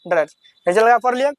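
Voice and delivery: normal talking voice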